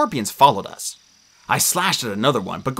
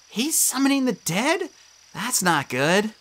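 put-on higher, slower girl's voice, worried; a lightly voiced worried girl; Annabeth's voice; 10 semitones higher, slower than usual. A narrator's reading. Narrator voicing Annabeth fretting over the dead.

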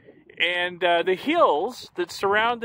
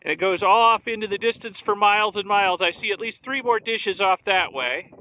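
raised voice over the wind; shouting over wind; 3 semitones higher than usual. Real, not acted. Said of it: "I hope you can hear me over the wind noise".

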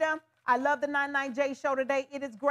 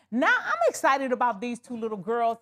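ordinary talk, nothing marked

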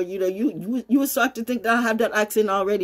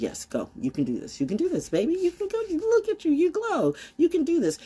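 lightly voiced cheering trees, climbing higher; encouraging trees; 10 semitones higher than usual. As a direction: cheer them on like a chorus of trees. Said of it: "So the tree said".

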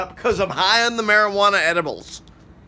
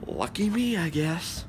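normal talking voice

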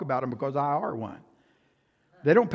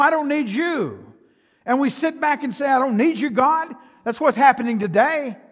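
louder and higher, driving each line home; full-force preaching; 8 semitones higher, 6 dB louder than usual. Acted. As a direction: preach it out hard to the congregation. Preacher builds on conceited church.

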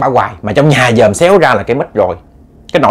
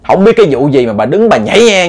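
plain reading voice

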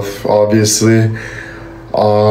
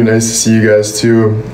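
ordinary talk

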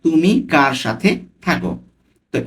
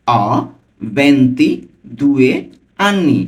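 ভেন্তিদুয়ে drawn out very slowly, syllable by syllable; broken up slowly; much slower than usual. Shown as a demonstration. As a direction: say অ, pause, then ভেন্তিদুয়ে stretched out slowly. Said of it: "আমি কিন্তু ভেঙে ভেঙে বলছি".

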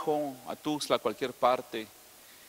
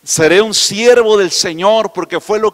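jubilant shout with laughter, much louder; preaching at full force; 5 semitones higher, 15 dB louder than usual. Acted. Preacher declaring lifelong calling to congregation, laughing and shouting. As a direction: declare it with joyful fire.

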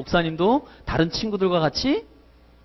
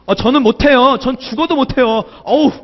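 higher, louder mock whine of refusal; hammed-up childish whining; whiny refusal; 7 semitones higher, 4 dB louder than usual. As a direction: play a kid protesting he just can't. Imagined refusal of the teacher.

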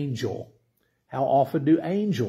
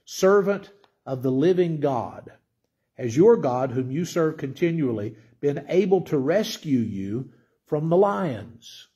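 usual speaking voice

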